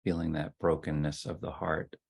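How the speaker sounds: soft, unhurried voice with long silences; guided meditation. Real, not acted. Leader settling the group into ten minutes of sitting, guided meditation. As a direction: ease them gently into stillness, phrase by phrase.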